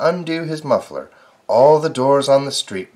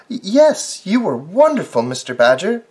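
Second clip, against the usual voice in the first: agreeing neighbours; 7 semitones higher, 4 dB louder than usual. Acted. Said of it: "the neighbors all agreed".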